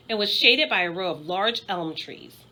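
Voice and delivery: ordinary delivery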